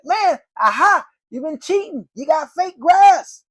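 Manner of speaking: loud, high, slow teasing shout; mock accusation. Salesman teasing a man mowing his lawn, loud mock accusing. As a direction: tease him like you caught him.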